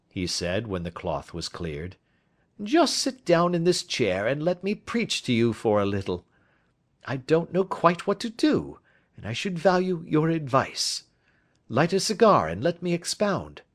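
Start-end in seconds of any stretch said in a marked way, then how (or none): 2.6-13.6 a touch higher, brisk and assured; a confident detective laying out his case; lightly voiced detective. Detective asking his friend for advice, lightly voiced. Holmes speaking over dinner.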